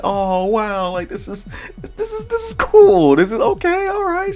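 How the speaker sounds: high squeaky imitation of his own awed self; mock amazed reaction. Player retells reacting to the surprise party, mock high-pitched. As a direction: play his past self, voice jumped up high. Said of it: "I was like".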